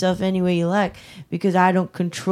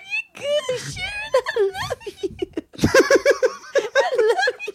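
much higher, laughing while speaking; laughing hard; 8 semitones higher than usual. Real, not acted. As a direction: squeak through uncontrollable laughter. Cracking up on phone.

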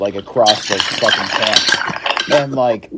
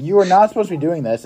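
fast, high mock telling-off; retold berating; 7 semitones higher, faster than usual. Counselor tells off a kid at camp, retold berating. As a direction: rattle off the reprimand, pitched up. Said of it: "berated him for".

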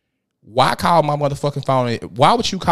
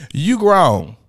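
emphatic repeat; 5 semitones higher, 5 dB louder than usual. Retold or imitated. Repeated louder after laugh.

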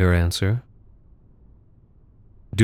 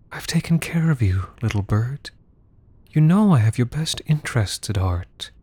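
menacing whisper; 2 semitones higher than usual. Acted. Whispered manipulation in embrace.

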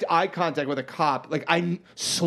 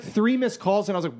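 ordinary delivery

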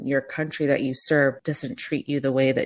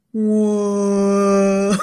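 drawn-out, stoned "Whoa", higher and louder; imitating Harry's whoa; 5 semitones higher, 5 dB louder than usual. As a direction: mimic a tripping man marvelling at a noise. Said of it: "He goes".

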